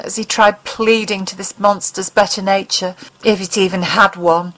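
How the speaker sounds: ordinary delivery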